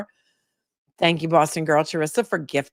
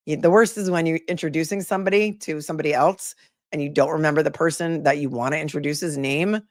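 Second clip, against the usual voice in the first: normal talking voice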